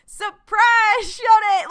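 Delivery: loud, high-pitched mock reveal; mock surprise outburst. Host voicing the show's sudden switch, mock triumphant shout. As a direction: shout it out high, springing the twist on them.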